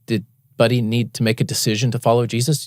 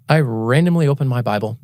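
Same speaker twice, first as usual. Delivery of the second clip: normal talking voice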